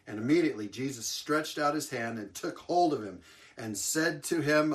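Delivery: ordinary talk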